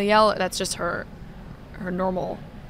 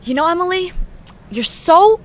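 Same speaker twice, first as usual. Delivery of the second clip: high-pitched, hammed-up maternal scolding; mad mother; 6 semitones higher, 5 dB louder than usual. Daughter imitating mother telling her off, exaggerated anger. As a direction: scold your daughter, leaning hard on each word. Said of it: "best interpretation of your mad mother".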